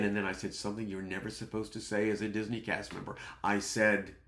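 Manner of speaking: usual speaking voice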